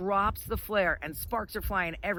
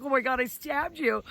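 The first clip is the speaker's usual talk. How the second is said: imitated panic, pitched higher; retold horror; 6 semitones higher than usual. Boss to the skier he just stabbed, horrified. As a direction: play the horrified boss, voice lifted.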